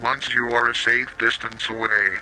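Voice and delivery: plain reading voice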